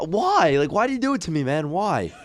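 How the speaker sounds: hammed-up hurt friend; high whiny complaint. Mock whining at co-host.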